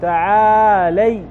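تعالي drawn out slowly, each sound clear; slow demo. Shown as a demonstration. Teacher correcting a slip for students, slow and clear. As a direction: spell the word out slowly for the class. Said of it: "عفوًا".

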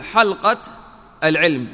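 "حلقة العلم" with hal-qa, no vowel on the lam; "حلقة" in two syllables, hal-qa, before "العلم"; lam with sukun. Shown as a demonstration. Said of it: "بإسكان اللام".

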